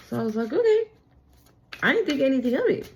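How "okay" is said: surprised okay. Retold or imitated. Retelling her own reaction.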